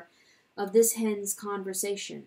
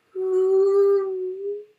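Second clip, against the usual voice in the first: high, loud, sobbing hoo; lonesome wail; 10 semitones higher, 8 dB louder than usual. Acted. A mouse wails alone in the night, high and sobbing. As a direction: let out a small lost wail. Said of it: "a sobbing, a lonesome wail".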